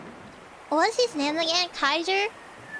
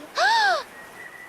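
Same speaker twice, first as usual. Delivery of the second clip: gasp; 13 semitones higher than usual. Real, not acted. Shocked at chat's question.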